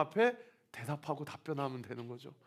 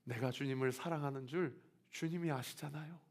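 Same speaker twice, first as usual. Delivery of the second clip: humble reply; 8 dB quieter than usual. Retold or imitated.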